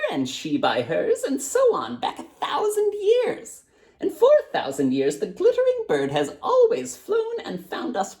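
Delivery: put-on high, frail old woman's voice; blind old woman. A narrator's reading. Blind woman tells the girl about the blue bird, high and frail. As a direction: tell it like a frail grandmother.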